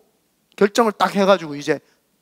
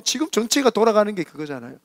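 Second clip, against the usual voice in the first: ordinary talk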